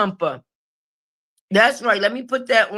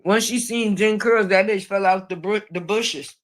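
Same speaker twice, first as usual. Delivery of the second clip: ordinary talk, nothing marked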